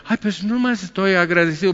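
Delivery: high mock-grateful churchgoer voice; gushing churchgoer. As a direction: pitch it way up, sugary thanks. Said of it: "vas a querer".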